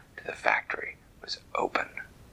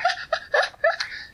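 high laugh. Acted. Character laughs.

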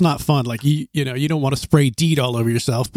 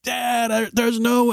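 put-on higher, soft complaining kid voice; whiny son; 7 semitones higher, 3 dB quieter, slower than usual. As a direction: mimic the boy's helpless protest. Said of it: "he's just like".

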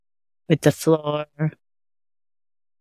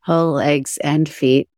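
guided meditation. Acted. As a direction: guide attention gently through the body.

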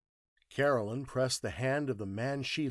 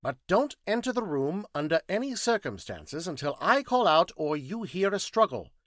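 high, loud, emphatic scientist; precise, high-pitched orders; formal instructions; 8 semitones higher, 7 dB louder than usual. Acted. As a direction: lay down the plan in a raised voice. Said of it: "gave some formal instructions".

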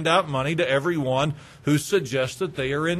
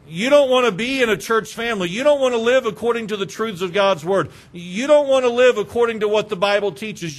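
louder, indignant preaching; indignant rebuke; 3 semitones higher, 3 dB louder than usual. Pretend. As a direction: scold them with righteous anger. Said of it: "what my flesh has wanted to say".

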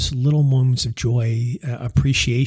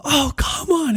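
high, exasperated outcry; pitched-up cry of exasperation; frustrated yell; 14 semitones higher, slower than usual. Retold or imitated. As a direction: throw it up in despair like a dad who can't believe it. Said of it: "when I yelled that".

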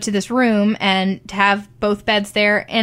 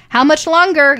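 loud, high, acted-out complaint; impatient; 7 semitones higher, 7 dB louder than usual. Patient to midwife after twenty minutes of stitches, impatient. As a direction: re-enact nagging for an end to it.

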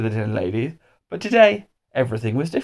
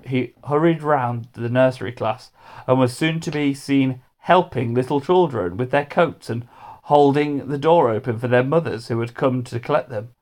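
ordinary delivery, nothing marked

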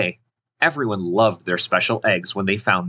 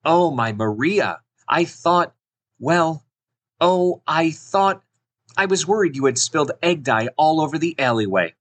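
higher, halting mother voice; flustered mom, pitched up; worried mama; 6 semitones higher than usual. Acted. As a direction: fumble for words in relieved worry. Mother voiced, relieved after worry.

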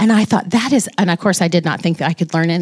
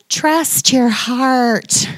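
slow, higher, sing-song mockery of a slogan; 5 semitones higher, much slower than usual. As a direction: drawl it out like a cheesy t-shirt motto. Mocking a hated slogan.

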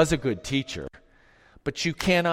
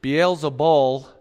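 slow, loud, deliberate Beelzebul; careful pronunciation; 7 dB louder, slower than usual. Shown as a demonstration. Preacher teaching the congregation the name Beelzebul, slow and loud. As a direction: spell out Beelzebul with a clear L at the end. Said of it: "Notice that it ends with a L".

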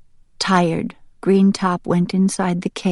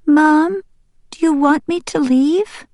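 put-on high, pleading child-character question; young duck Green Top, hurt; 10 semitones higher, 4 dB louder than usual. Young duck asks whether Mom wants him gone, hurt. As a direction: ask mother in a high young voice.

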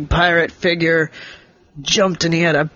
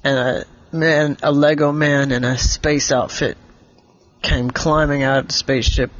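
ordinary delivery, nothing marked

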